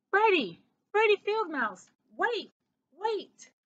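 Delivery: high, urgent call down the road. A narrator's reading. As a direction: shout after someone walking away. Calling after departing mouse down road.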